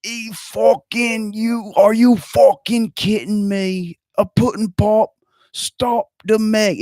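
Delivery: high, very slow Cosby-Australian hero; hammed-up Cosby hero stopping the Meg; part Cosby voice. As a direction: drawl "A pudding pop?" in mock Cosby. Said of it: "it might have been part Cosby".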